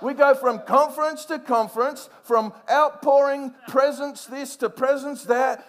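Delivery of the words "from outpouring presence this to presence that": ordinary talk, nothing marked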